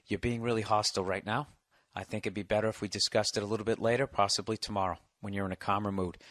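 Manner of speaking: soft, low-key, unbothered reply; deliberately serene, taking the wind out of her; calm and measured. Pretend. Scene: boyfriend calmly deflecting his hostile girlfriend, soft and serene. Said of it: "practice being calm".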